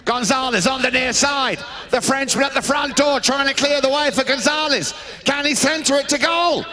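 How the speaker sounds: spirited commentary, pitch climbing towards the end; live play-by-play. Real, not acted. Commentator follows Gonzalez towards goal, rising play-by-play. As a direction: keep the call driving up towards the goal.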